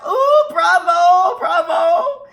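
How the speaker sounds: slow, high, loud cheering with a laugh; delighted bravo. Acted. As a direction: applaud with delight. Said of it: "Chinchetta clapped".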